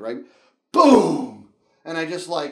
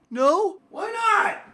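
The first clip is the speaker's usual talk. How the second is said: high, squeaky put-on protest; pretend-upset high voice; mock dismay; 6 dB louder, much slower than usual. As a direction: plead it high and louder, playfully hurt. Mock dismay at viewer's imagined answer.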